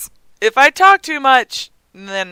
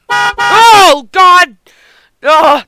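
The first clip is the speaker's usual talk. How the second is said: high, very loud cry of fright; loud jolt of alarm; startled shriek; 6 semitones higher, 8 dB louder, much slower than usual. Real, not acted. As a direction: scream it as if caught off guard. Startled by game.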